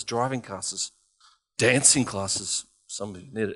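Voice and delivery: low, soft reassuring preaching; gentle encouragement. Acted. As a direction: comfort them quietly.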